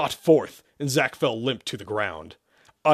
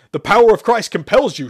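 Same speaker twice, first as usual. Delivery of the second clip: high, loud exorcist's cry; roar; 9 semitones higher, 11 dB louder than usual. Acted. Priest commands the demon to leave, roaring. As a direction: roar the chant with full force. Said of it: "Sherman roared".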